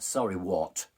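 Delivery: loud, imperious comeback; stern challenge. Retold or imitated. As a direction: challenge the apology like a strict master. Master challenges client's apology.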